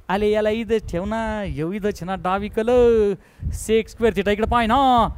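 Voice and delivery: lisping, childlike put-on coaxing; mock baby talk. Teacher coaxing a slow student, mock baby talk.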